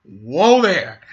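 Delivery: loud startled whoa; shocked whoa. Real, not acted. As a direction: recoil at the line. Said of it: "I'm like".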